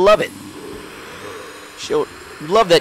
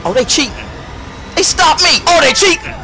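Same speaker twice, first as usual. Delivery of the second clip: outraged; 10 semitones higher than usual. Real, not acted. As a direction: cry out that it's unfair. Game cheat outrage.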